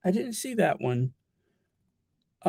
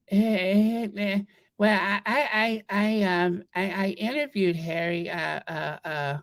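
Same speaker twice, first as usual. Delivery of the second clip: mocking stammer; 3 semitones higher than usual. Mocking stammer about interviewing Harry.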